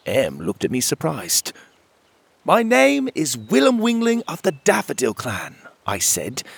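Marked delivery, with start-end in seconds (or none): 2.4-5.6 friendliest voice. A narrator's reading. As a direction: greet the hidden fairies as kindly as you can. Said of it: "friendliest voice".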